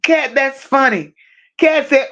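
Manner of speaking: usual speaking voice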